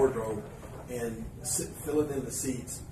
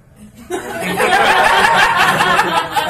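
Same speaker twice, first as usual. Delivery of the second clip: loud, high, helpless laugh; real laughter at the prank plan; burst of laughter; 16 dB louder than usual. Real, not acted.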